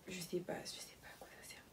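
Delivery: puzzled whisper. Real, not acted. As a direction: whisper it to yourself. Puzzling over a top.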